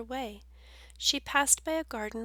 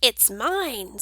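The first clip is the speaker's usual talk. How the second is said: louder little squeak; squeaky bug; 8 dB louder than usual. Acted. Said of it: "squeaked the squash bug".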